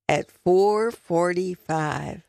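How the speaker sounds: ordinary delivery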